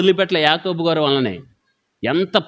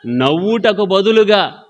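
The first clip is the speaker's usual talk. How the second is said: fervent preaching; 3 semitones higher than usual. Acted. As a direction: drag each command out like an altar call. Preacher urging repentance. (నెమ్మదిగా, గట్టిగా వేడుకునే ప్రసంగ శైలి)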